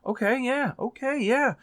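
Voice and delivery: playing along, pretending to be convinced; mock agreeable nodding. Hearing reviewers' points, going along with a high sing-song agreement. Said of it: "and I go".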